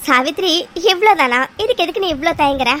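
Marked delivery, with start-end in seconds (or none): 0.0-0.5 laughing. Acted. Relieved laugh at small worry.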